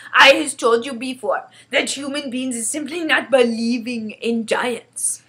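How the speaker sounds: loud, emphatic giant voice; insistent friendly giant. Acted. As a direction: remind firmly as a simple-spoken giant. BFG insists.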